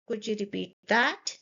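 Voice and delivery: "that" lifts upward at the end; rising question tune. Shown as a demonstration. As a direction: send the voice up on "that". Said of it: "My voice is going up".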